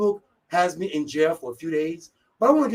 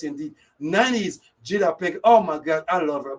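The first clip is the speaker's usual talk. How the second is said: ordinary delivery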